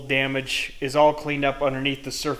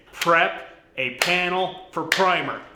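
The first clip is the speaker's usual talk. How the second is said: slow and deliberate, with pauses between words; measured reset, each word set apart; slow, careful enunciation; 3 semitones higher, slower than usual. Shown as a demonstration. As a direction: spell out "prep a panel for primer" word by word. Resets after garbled tongue twister.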